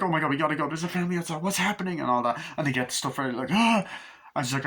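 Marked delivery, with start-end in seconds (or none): 0.0-2.0 panicked urging. Acted. Family panicking to flee. 3.5-3.8 high scream. Acted. Attack scream.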